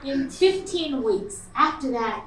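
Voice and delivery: normal talking voice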